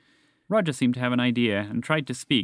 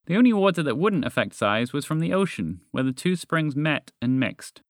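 plain reading voice

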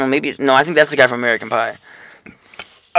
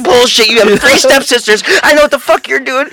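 retold freak-out; 10 semitones higher than usual. Friend retells the woman rejecting Ricky's excuse, high and outraged. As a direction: imitate a woman freaking out and calling him out. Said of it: "she was freaking the fuck out".

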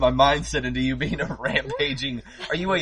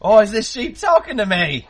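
put-on higher, startled Australian accent; put-on Aussie farmer, amazed a sheep talks; 8 semitones higher than usual. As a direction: farmer shocked by a talking sheep, high Australian voice. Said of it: "you're gonna be an Australian sheep farmer".

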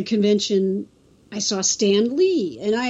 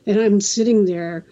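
ordinary delivery

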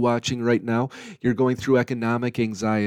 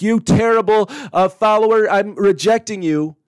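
louder, a hammed-up stern rebuke; mock harsh scolding; 6 semitones higher, 5 dB louder than usual. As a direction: play a harsh judge, half in jest. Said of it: "to scold you".

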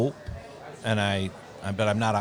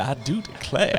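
Southern belle. Pretend. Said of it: "you're sounding good over there".

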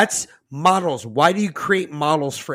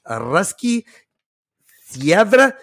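a hint of mock Russian accent, slowed; 3 semitones higher, much slower than usual. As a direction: fake a Russian-sounding name. Mangling Russian agency name.

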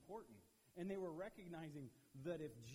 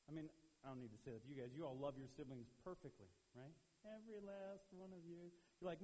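normal talking voice

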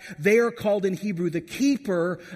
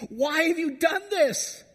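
high, slow, crying plea of a frantic mother; distraught mom crying out; 9 semitones higher, slower than usual. Acted. Mother crying out to her teenage son, high anguished wail. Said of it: "it's the mom crying out".

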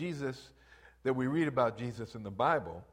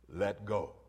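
voice of God; 6 semitones lower than usual. Retold or imitated. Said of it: "the voice of God says".

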